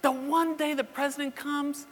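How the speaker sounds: high, loud, hammed-up wail; exasperated. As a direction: protest to heaven in a drawn-out, high-pitched cry. Acted exasperated complaint to the Lord.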